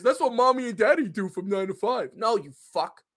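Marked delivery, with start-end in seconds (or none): 0.0-2.1 a dumb fan answering in a silly voice; lightly voiced clueless fan; mocking dumb fan. Fan answering the question in a dopey voice. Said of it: "If you ask them what a work is".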